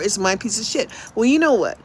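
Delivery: ordinary talk, nothing marked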